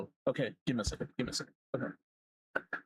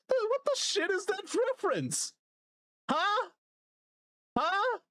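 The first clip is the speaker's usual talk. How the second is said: very high-pitched mock outrage; incredulous squeal. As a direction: squeak it out in utter disbelief. Escalating disbelief at the reference.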